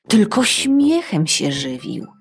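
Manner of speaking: usual speaking voice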